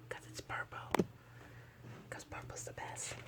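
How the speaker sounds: soft unvoiced whisper; whispered aside. Real, not acted. Vlogger whispering about her favorite purple page. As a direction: whisper it like a little secret.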